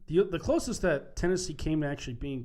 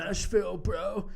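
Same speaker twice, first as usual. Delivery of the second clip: frat bro; 6 semitones higher, 4 dB louder, slower than usual. Retold or imitated. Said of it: "like a frat boy going through puberty".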